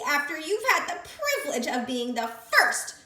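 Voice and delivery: high, loud, gloating creature voice; sandwich creature. Acted. Creature bossing the captured piglet. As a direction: gloat over her catch.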